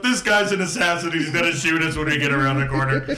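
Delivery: high, loud, laughing panic; paranoid crew member. Acted. Player voicing a crew member who suspects an ambush, high laughing panic. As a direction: babble it like a spooked crook.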